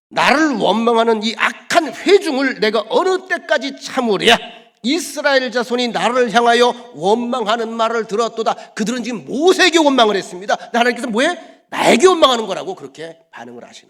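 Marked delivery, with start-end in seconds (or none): 0.1-8.7 booming, louder divine rebuke; God's voice. Acted. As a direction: thunder it as an angry God. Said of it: "하나님께서 말씀하시기를, 이게 무서운 말입니다". (하나님의 노한 음성으로)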